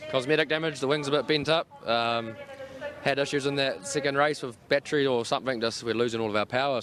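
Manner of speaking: normal talking voice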